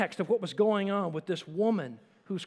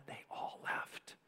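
whisper; 15 dB quieter, slower than usual. A narrator's reading. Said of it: "gospel narration, whispered".

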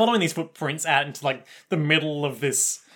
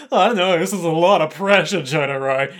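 mock flustered protest; 2 semitones higher, 5 dB louder than usual. Josuke balking at being handed the bullets, mock flustered. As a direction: protest under pressure, playing it up.

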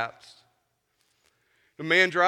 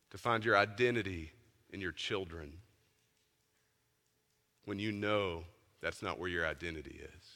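hushed preaching; 8 semitones lower, 5 dB quieter than usual. Acted. Preacher quietly urging his church to examine their hearts, low and soft. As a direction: draw the congregation inward with a low, soft voice. Quiet reflective call to the congregation.

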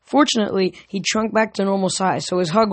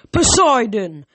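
loud, raised call of a name; commanding shout; angry roar; 4 semitones higher, 5 dB louder, slower than usual. Acted. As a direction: thunder the name across a hall. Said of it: "a voice roared".

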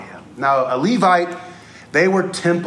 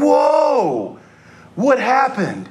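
startled; 9 semitones higher, much slower than usual. Retold or imitated. Startled by a yelling driver.

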